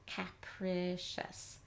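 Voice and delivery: "capricious" very slowly as "cap-rih-shus"; chunk by chunk. Shown as a demonstration. Teacher blending chunks with the special ending, slow and segmented. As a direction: stretch "capricious" into three short-vowel chunks. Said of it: "blend the two chunks together".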